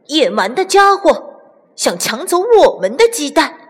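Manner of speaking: high, heated defiance; indignant young rooster squaring up for a fight; angry, indignant. A narrator's reading. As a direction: say it high and angry, ready to charge. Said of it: "愤怒地冲了出去".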